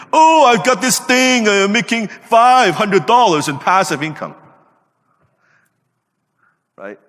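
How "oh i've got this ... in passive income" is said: high, loud, slow show-off boast; mock hype-bro voice, much higher and louder; mocking social media guy. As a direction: ham up a bragging young poster. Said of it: "young guys posting on social media".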